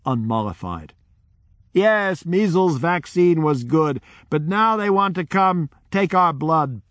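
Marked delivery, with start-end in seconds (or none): 1.7-6.8 high, mildly louder retort from the crowd; mock crowd shout. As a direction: toss it back at him defiantly. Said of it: "The crowd shouted back".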